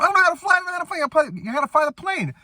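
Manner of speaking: high, squealed back-and-forth protest; imitated frantic movie argument; mock panic. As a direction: bicker in a hammed-up, high-pitched panic. Retold argument as plane crashes.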